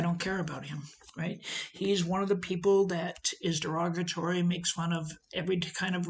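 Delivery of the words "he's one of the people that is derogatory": ordinary talk, nothing marked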